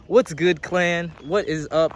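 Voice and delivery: loud, upbeat hello; hype greeting. Real, not acted. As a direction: greet the crowd with energy. Greeting the viewers.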